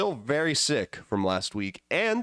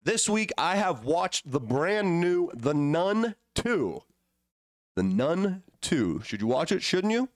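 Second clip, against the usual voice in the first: announcer voice; 5 semitones higher, slower than usual. Acted. Dramatic announcer tease.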